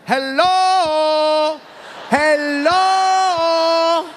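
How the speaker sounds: high, long-held sung-out hello; mock warning call. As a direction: stretch it out high to warn someone. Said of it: "bartender is trying to warn me".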